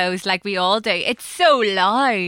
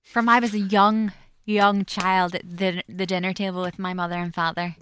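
ordinary delivery

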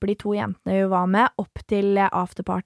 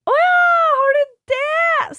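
high, loud squeal of fake surprise; a mocked-up cheery gasp of surprise; 6 dB louder than usual. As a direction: play along in a high, loud mock-delighted voice. Mock delight at baby question.

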